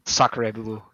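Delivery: mock French exclamation; hammed-up French accent. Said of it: "Is he French?".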